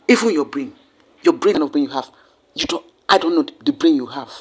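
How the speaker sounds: loud, low, furious lecturing voice; angry scolding. Acted. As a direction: berate them like a fed-up masseur. Mock scolding the country's critics.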